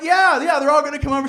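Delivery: high loud eager yeah; eager yes. Retold or imitated. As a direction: blurt it out nervously. Nervous eager reply.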